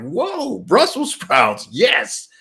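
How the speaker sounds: thrilled at the find, voice jumps up; excited. Retold or imitated. Spotting Brussels sprouts on app.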